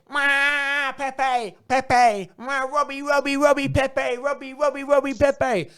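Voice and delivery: high, sustained mock wailing chant; mock hysterical fan chant; mocking fan hype. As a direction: wail the names like over-excited fans, taking the mick. Mocking Arsenal fans' hype chanting.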